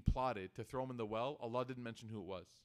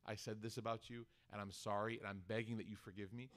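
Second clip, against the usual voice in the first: a soft, lowered apology as if ashamed to say it; softer, humbled plea for forgiveness; quiet ashamed confession; 5 semitones lower, 7 dB quieter than usual. Retold or imitated. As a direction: murmur the confession, humbled and sorry. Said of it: "looking at the eyes of a person and saying".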